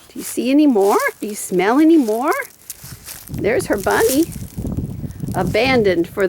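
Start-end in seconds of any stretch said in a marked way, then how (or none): none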